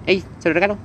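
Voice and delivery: a hint of a mother threatening her tantrum-throwing kid; mother scolding. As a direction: mom warning her tantrum child she'll give him away, mock stern. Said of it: "tu mamá dice".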